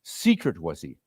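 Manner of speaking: ordinary delivery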